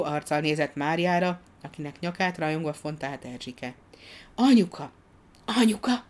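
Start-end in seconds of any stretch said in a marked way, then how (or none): 4.3-6.0 put-on high, louder child's call; two bright little calls, pitched high; trying out a name. A narrator's reading. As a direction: try the new word out with delight. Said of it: "próbálgatta Mária néni új nevét".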